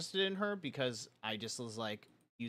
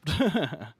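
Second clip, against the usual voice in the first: big burst of laughter; loud laugh; 5 semitones higher, 12 dB louder than usual. Real, not acted. Laughing with a friend at the story.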